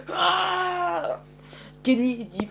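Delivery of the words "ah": high, drawn-out "aah" scream; acted scream. A narrator's reading. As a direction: scream as if badly hurt, without much volume. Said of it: "il hurle, il fait".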